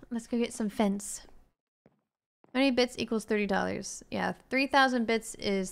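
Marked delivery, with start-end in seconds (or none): none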